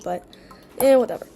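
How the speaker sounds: normal talking voice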